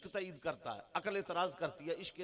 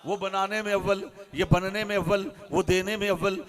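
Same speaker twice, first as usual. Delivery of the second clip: loud, rhythmic preaching chant; rising sermon; 13 dB louder than usual. Acted. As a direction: hammer each line home to the gathering. Preaching at full force. (ऊँची आवाज़ में जोशीली तक़रीर)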